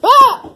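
short, high, loud shriek; sudden yelp of fright; startled scream. Pretend. Vlogger spooked by the computer error, startled scream.

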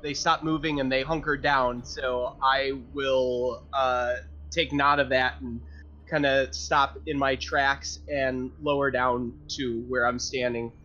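normal talking voice

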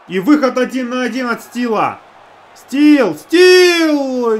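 rising excited breakaway call; excited shout. Real, not acted. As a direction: yell the player's name in alarm. Goalie play-by-play, breakaway.

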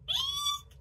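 tiny very high squeak; high-pitched frightened eek. A narrator's reading. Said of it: "squeaked the bear".

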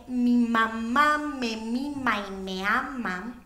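slow, lingering schoolchild recital; drawn-out, measured recitation; primer recitation. Acted. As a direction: stretch out each word like a rote refrain. Primer refrain repeated mid-monologue.

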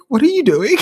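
high, loud mock challenge; incredulous police officer. Police confronting toll-booth builders, mock incredulous. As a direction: exclaim it as a disbelieving cop, half laughing. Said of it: "police who were like".

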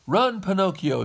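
high, loud urgent shout; shouted warning. A narrator's reading. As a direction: shout it to make someone flee. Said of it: "shouted Geppetto".

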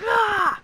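loud high cry; pained yell. Real, not acted. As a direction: yelp at taking a hit. Hit during game fight.

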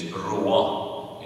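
"brua" drawn out slowly; slow sample word. Shown as a demonstration. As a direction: lay out "brua" slowly, syllable by syllable.

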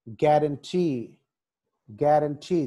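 Guarantee with a long, stressed "tee"; slow guarantee, weight on "tee"; last-syllable stress. Shown as a demonstration. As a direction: draw out the end of guarantee. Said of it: "last syllable".

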